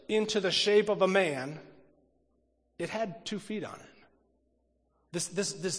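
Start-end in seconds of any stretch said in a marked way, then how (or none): none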